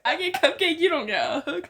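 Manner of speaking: loud giggly mock brag; real laughing tease. Real, not acted. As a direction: joke while laughing. Joking out of character.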